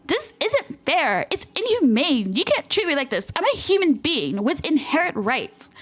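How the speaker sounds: high, slow, drawn-out whine; whiny protest. Acted. The customer whines slowly at the manager, high and outraged. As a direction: stretch every word like a spoiled brat.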